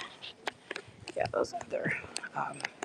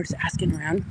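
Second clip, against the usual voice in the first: ordinary talk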